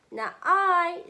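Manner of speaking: high, loud "Not I"; cat refusing. Acted. Mother voicing the cat's refusal to her son, high and loud. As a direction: turn the hen down sharply.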